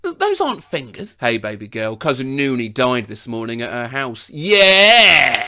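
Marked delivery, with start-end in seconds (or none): 4.3-5.5 loud cheer. Acted. Cheerful reply to news of death.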